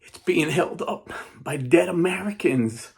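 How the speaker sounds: horrified first mate; shocked report. Retold or imitated. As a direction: deliver the grim news aghast. First mate reporting grim news.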